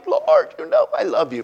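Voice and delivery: very high-pitched protesting reply; high, pleading mock reply; Peter. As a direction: answer in a squeezed, high acted voice. Acts out Peter answering again.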